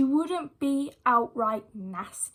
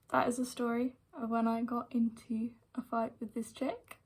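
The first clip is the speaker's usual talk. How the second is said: 1 whispered sign-off; 8 dB quieter than usual. Real, not acted. Wrapping up the story.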